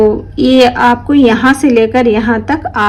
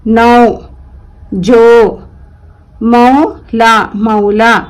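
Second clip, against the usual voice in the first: each word read out loud, one by one, with a pause after it; slow word-list reading; 3 dB louder, much slower than usual. Shown as a demonstration. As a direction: read every word clearly for the class. Reads word list aloud. (धीमे और साफ़ शब्द पढ़ना)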